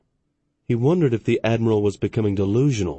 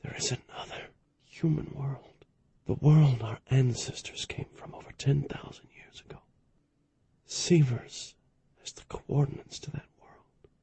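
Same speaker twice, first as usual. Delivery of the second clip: last words breathed out faintly; barely a whisper; 4 semitones higher, 11 dB quieter than usual. A narrator's reading. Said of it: "his voice barely a whisper".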